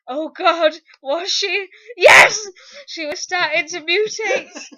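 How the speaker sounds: loud, giggly reading; laughing while reading. Real, not acted. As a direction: barely keep it together. Reader laughing through lines.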